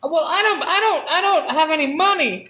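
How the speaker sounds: high, hesitant mouse voice; timid mouse. Acted. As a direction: stammer like a shy little mouse. Mouse voice in skit.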